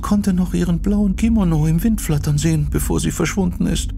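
put-on very high, hushed fearful girl; frightened Yoko. Yoko tells Sachiko of the kimono woman, frightened. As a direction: confide the terror in a high, hushed voice.

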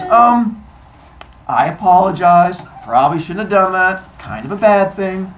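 loud, halting sheepish admission; awkward apology. Acted. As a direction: own up awkwardly to a crowd. Goddess apologizes to staring crowd.

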